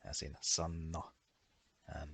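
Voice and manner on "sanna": sanna with a held n; sanna, pitkä n; long Finnish n. Shown as a demonstration. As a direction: hold the nn in sanna. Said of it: "the double N".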